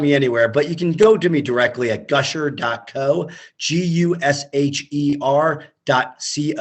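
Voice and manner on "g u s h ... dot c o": slow, letter-by-letter spelling. Real, not acted. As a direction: spell it out slowly for someone noting it down. Spelling out the web address.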